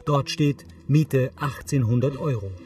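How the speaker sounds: ordinary delivery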